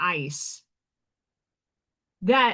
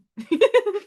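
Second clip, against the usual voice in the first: high bright burst of laughter; delighted laugh; 9 semitones higher, 4 dB louder than usual. Real, not acted. Laughing at the lineman spotting her accent, high laugh. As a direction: laugh out loud, tickled. Laughing after retelling the exchange.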